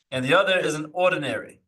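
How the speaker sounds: ordinary talk, nothing marked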